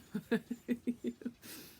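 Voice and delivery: high, loud, rapid cackle; mocking laugh. Being scoffing at talk of love, mocking cackle. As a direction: cackle at the very idea.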